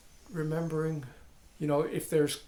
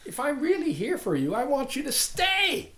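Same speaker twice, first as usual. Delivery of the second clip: high, loud, playful insistence; effusive mock welcome; 10 semitones higher, 5 dB louder than usual. Teacher addresses the unwanted problem, comically effusive welcome. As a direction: climb in pitch to an emphatic invitation.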